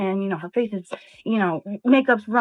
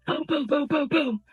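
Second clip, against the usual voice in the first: rhythmic booms mimicking pounding on a door; mock pounding noise; imitated door banging; 4 semitones higher than usual. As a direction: voice the knocks as a quick run of booms. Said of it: "he hears this".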